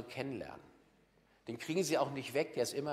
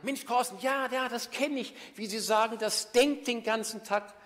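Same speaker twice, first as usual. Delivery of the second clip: high, loud, eager agreement; excited listener; 9 semitones higher, 6 dB louder than usual. Retold or imitated. Swiss listener telling the speaker he knows it, high and eager. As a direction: gush like a fan who finally gets it. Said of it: "hat er gesagt".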